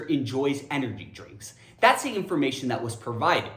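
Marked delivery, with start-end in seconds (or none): none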